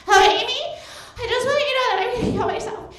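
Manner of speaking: high, fast mock friend voice; friend's voice. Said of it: "Here she is".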